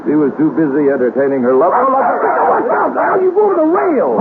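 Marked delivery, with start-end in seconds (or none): 1.8-4.1 high, stretched cry of alarm as the rail gives way. Acted. Man on the Widow's Walk yelling as the rail gives way, alarmed. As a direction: yelp out a warning, pitched way up.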